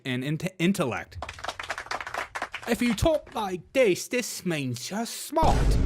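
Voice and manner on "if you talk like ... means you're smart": mock posh British accent, pitched high and drawn out slowly. Mocking how Brits sound smart, hammed-up posh British accent. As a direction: lampoon a snooty British accent. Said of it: "people with a British accent".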